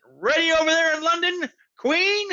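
high, loud call to London. A narrator's reading. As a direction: call across a long distance. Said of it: "said the president".

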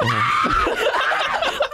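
chimp-like shrieking laugh; howling laugh. Real, not acted. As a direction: howl with laughter. Howling laugh remembering joke.

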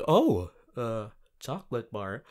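ordinary talk, nothing marked

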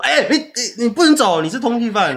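high, laughing pretend accusation; imagine mom daring to stop the fugitive; mock confrontation. Mom pretending to tell the fugitive he can't leave, high and laughing.

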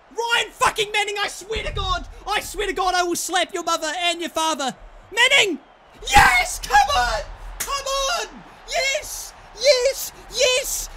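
real high, loud screaming elation; wild, high-pitched triumphant shouting; ecstatic celebration. Real, not acted. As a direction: scream it like your team just scored.

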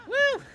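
high, loud woo; excited whoop. Real, not acted. Player cheering their own car hopping, excited whoop. Streamer whoops during play.

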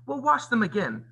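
high, sharp retort in story reading; snapped. A narrator's reading. Cruel stepmother answers Cinderella's protest, snapping. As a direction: snap back at an objection. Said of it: "snapped the cruel woman".

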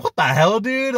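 high, loud what the hell; retold outrage at a flip-flopper; incredulous. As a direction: disbelief shooting up on dude. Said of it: "And I was like".

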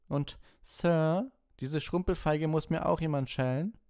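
high, loud schoolboy demand; gloating. A narrator's reading. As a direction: push the teacher further with barely hidden glee. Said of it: "konnte ein gemeines Lachen kaum unterdrücken".

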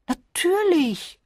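high, drawn-out of course; high, slow emphatic older lady; matter-of-fact. A narrator's reading. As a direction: sing it out as if obvious. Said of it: "entgegnete Misses Bantry".

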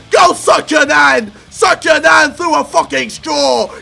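loud, hammed-up angry bellowing; rage mode. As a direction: roar like an over-the-top angry strongman. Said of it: "bring on rage mode".

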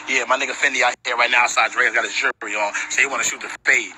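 ordinary talk

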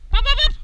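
very high, loud popping calls; pop-pop to draw the dog in; lure noises. Pretend.

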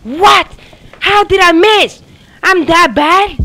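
loud, high, disbelieving rage; frustrated shouting. Real, not acted. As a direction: yell at the game for the miss. Gamer raging at missed shot.

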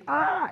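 short high mock scream; a brief cry flung up high. Victims leaving earth screaming.

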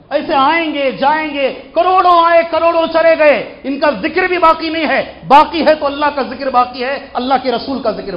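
loud, high, ringing defiance; full-force preaching. Real, not acted. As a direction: thunder it out to the congregation.

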